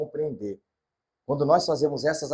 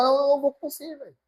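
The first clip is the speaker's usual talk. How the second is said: higher voice of God refusing; 12 semitones higher, faster than usual. Acted.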